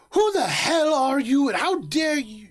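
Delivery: high, loud indignant outburst, dropping off at the end; outraged mother. Acted. Mother wheeling on whoever bothered her child, outraged.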